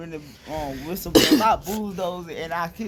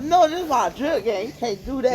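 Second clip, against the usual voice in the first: defiant protest; 6 semitones higher, 7 dB louder than usual. Pretend. Kid refusing the coach, defiant and loud. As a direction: refuse it, dragging the words out.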